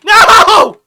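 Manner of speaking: long, very high full scream; anguished scream. Real, not acted. Gamer losing to the one with the knife, screaming. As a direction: scream it out at the top of your voice. Gets killed in the game.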